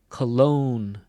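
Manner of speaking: "cologne" said co-LOGNE, slow, weight on the end; stress on second syllable. Shown as a demonstration. Teacher contrasting two words, stressing the end of "cologne". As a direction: lean into "logne", keep "co" light. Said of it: "Where do you hear the stress".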